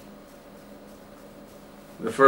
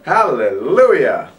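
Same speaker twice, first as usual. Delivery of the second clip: joyful cry; 6 dB louder, slower than usual. Real, not acted. Finally going to bed.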